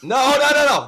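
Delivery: high, loud flurry of denial; caught-out, high-pitched protest. Real, not acted. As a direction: blurt out a hasty denial. Teased about subscribing, denies it.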